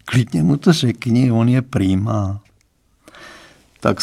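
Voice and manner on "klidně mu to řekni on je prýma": plain reading voice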